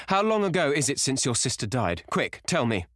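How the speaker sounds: high, pressing question; desperate, high-voiced plea; urgent cry. A narrator's reading. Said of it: "he cried".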